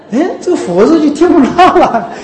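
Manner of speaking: loud high laughing complaint. Real, not acted. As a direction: burst out laughing in disbelief. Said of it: "laughs at the bad chanting". (大笑着抱怨)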